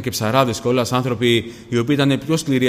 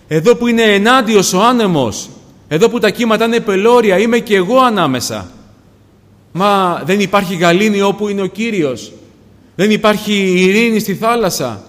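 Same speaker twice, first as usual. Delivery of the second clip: loud, high, urgent sermon voice; raised, fervent pulpit delivery; impassioned preaching; 8 semitones higher, 7 dB louder than usual. Real, not acted. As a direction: preach at full force, crying out to the congregation. Preaches at full force.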